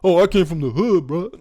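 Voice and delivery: half-mocking act of an online tough guy. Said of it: "trying to act tough online".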